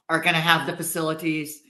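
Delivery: strained, sore throat; speaking through a sore throat. Real, not acted. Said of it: "I have a sore throat".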